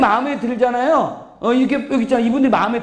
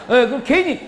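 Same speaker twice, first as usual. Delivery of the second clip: ordinary talk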